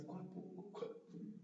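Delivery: very soft, near-whispered wondering; thinking to oneself. Retold or imitated. Applicant choosing which jobs to write down, hushed self-questioning. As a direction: imitate a private, puzzled murmur. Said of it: "me puse a pensar".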